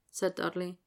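ordinary delivery, nothing marked

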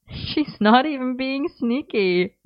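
high, slow words through a giggle; giggling. Acted. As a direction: still laughing at the setup. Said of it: "Merlin giggled again".

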